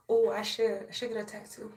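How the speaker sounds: usual speaking voice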